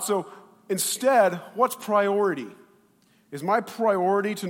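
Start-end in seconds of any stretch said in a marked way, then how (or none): none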